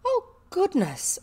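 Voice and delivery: high, slow amazed exclamation; exclaiming. A narrator's reading. Girl amazed at her friend's offer, exclaiming. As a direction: exclaim in wide-eyed amazement. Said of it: "exclaimed Ermengarde".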